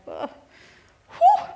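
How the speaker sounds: loud, high overwhelmed exhale whew; emotional whew. Retold or imitated. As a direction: blow out a big shaky breath of emotion. Overwhelmed admitting estrangement to friend.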